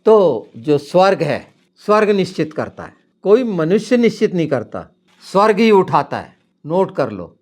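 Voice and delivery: high, loud sermon proclamation; preaching at full force. Acted. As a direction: hammer each line to the congregation. (पूरे जोश से ऊँची आवाज़ में प्रवचन)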